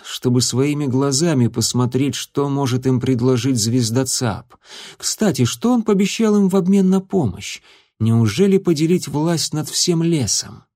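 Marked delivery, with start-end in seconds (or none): none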